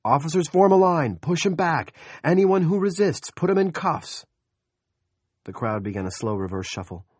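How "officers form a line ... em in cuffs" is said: loud high shouted commands; shouted orders. A narrator's reading. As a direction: command the line at full voice. Officer commanding crowd.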